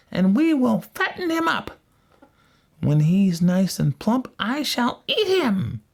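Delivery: high-pitched, commanding witch voice; wicked witch crying out. A narrator's reading. Witch waking Gretel to work, high wicked cry. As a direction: order the child about in a high witch voice. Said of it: "and cried".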